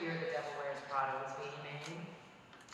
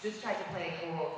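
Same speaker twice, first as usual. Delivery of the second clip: normal talking voice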